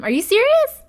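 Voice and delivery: high, loud exasperated disbelief; outraged at the game, pitch jumps up. Real, not acted. Game refuses her hug action.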